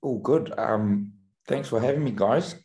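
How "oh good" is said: usual speaking voice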